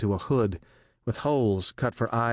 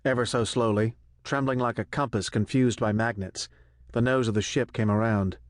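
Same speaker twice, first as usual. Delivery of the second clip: plain reading voice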